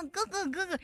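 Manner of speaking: mock a squeezed sweet little voice; squeezed cutesy voice. Debater parodies someone squeezing the throat, mock cutesy voice. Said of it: "你看这个嗓".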